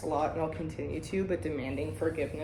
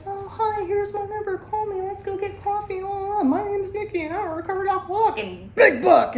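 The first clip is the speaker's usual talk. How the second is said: much higher, sugary, rushed "Oh, hi, here's my number"; fake-cheery meeting persona; 12 semitones higher, 3 dB louder than usual. Host mocking a member performing recovery at a meeting, mock-bubbly and high. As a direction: play the over-eager sober newcomer showing off. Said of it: "you're putting on a show".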